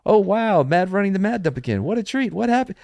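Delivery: high-pitched sarcastic wonder; mock sarcastic gushing. Host reading an anonymous chat message aloud, sarcastic gushing. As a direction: gush in a high, put-on voice. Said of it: "I feel as though there's sarcasm".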